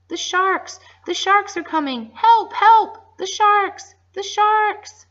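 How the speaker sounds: screaming high and loud in terror; panicked cries for help; cried out in fear. Acted. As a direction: pirates screaming as sharks come. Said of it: "cried out in great fear".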